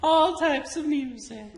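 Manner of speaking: high, drawn-out mock quote; mocking mimicry. Repeating coworker's claim to listen to everything, mocking mimicry. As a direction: stretch it out in a high, put-on voice.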